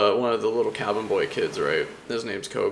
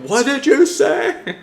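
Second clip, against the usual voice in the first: much higher, louder, slow, half-laughing shriek; outraged shriek; 10 dB louder, slower than usual. Retold or imitated. Pirate captain to the boy who insulted her, in a high, loud mock-outraged shriek.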